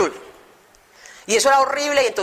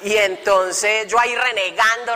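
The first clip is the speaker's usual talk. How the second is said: ordinary talk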